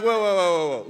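type-shouting in capitals, acted out; angry capital letters. Reacting to the email from his future wife, mock-angry whoas. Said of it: "Like, capital letters".

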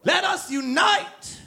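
high, slow shouting rally voice; booming rally speech. Acted. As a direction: rouse the crowd like a demagogue. Rallying the planet against aliens.

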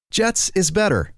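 ordinary talk

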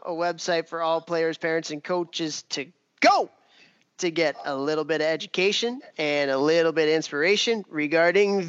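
upbeat announcer read over music; podcast host intro. Acted. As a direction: welcome listeners like a radio host.

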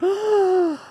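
high, sharp theatrical gasp; shocked mock gasp. Everybody reacting to the racism claim, mock shocked gasp. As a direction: gasp as if scandalized. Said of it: "everybody was like".

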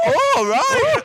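shrill mock huff at the landlady; mock offended. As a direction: play the indignant prig. Mocking the rude landlady.

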